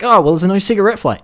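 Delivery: high, loud, fast sassy comeback; snappy retort. Acted. Woman snaps back at the captain, high, fast and loud. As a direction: fire it back at him.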